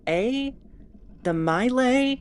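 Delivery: slowly testing "mile" as an alternative; questioning pronunciation. Shown as a demonstration. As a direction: stretch "mile" into a long, doubtful long-i. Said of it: "We aren't sure how it was pronounced".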